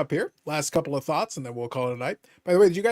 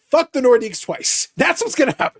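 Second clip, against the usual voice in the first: much higher, louder triumphant jeer; gloating taunt; 10 semitones higher, 5 dB louder than usual. Pretend. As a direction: rub it in like a sore winner. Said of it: "It's like".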